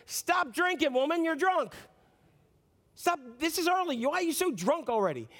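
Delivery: indignant priest, voice lifted; acted scolding. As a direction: scold her as if she were drunk.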